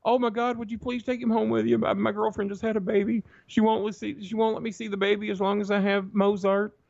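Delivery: high, pleading voice of a desperate stranger. Retold or imitated. Desperate man begs a stranger to take his dog, high pleading voice.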